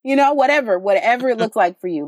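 ordinary talk, nothing marked

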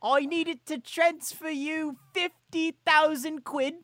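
high, slow British scammer; mock British accent. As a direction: draw it out in fake British. British scammer bit continues.

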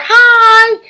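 high, loud singing-out hello; excited greeting. Real, not acted. Host greets her aunt on the broadcast, excited high call.

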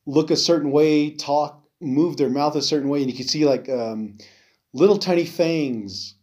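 ordinary talk

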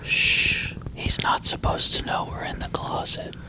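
hushed unvoiced whisper. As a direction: whisper so he won't hear. Hiding in closet, whispering.

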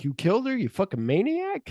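high-pitched mock outrage; mock accusation. Other guy accuses the killer, high mock outrage. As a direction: accuse him in a hammed-up squeal.

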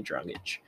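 Dragic as DRAH-gitch, ending in "itch"; probable pronunciation. Shown as a demonstration. Host guesses at the player's name, Dragic as DRAH-gitch. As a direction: pronounce Dragic with a final "ch" sound. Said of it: "It's probably Dragic".

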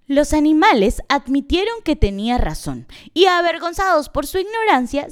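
usual speaking voice

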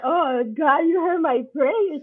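thankful awe. Retold or imitated. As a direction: marvel at answered prayer. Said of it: "I'm like".

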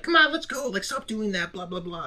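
high, quiet retold nagging; imitated pleading wife. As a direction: imitate a wife pulling her husband away, high and hushed. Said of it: "but she was like".

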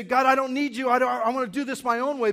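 loud, high, fast defiance toward God; defiant cry. Retold or imitated. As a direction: shout it out like a stubborn rebel. Voicing his own defiance of God.

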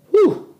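sharp high exhale of relief; high loud whew. Real, not acted. Wrapping up a rant with a loud whew.

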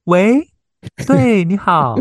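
high, bright phone answering; phone voice uptalk. Shown as a demonstration. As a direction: pitch way up to answer a call. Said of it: "就是那个什么接电话模式嘛".

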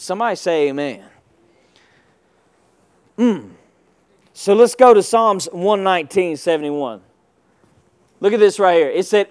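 ordinary delivery

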